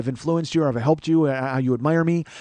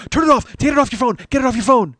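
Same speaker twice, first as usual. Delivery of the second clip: high, loud mimicked panic; panicked urging; 10 semitones higher, 8 dB louder than usual. Friend to comic as waitress approaches, panicked. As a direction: urge frantically, acting out the alarm.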